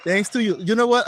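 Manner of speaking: usual speaking voice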